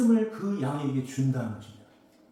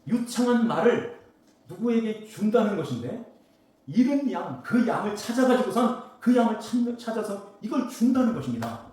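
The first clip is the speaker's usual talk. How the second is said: raised, rising sermon voice; preaching; 6 semitones higher, 7 dB louder than usual. Acted. Preacher to congregation about the lost sheep, fervent preaching. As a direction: drive the point home to the pews.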